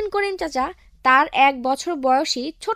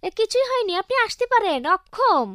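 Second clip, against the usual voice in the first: cheeky high voice; 7 semitones higher than usual. Acted. Boltu cheekily inverts words.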